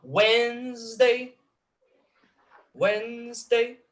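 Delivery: "Wednesday" high, drawn out in two syllables; strong-weak trochee. Shown as a demonstration. Lecturer scanning the lyric, high slow "Wednesday", strong then weak. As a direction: intone "Wednesday" slowly, first syllable strong. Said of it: "sílaba forte, sílaba fraca".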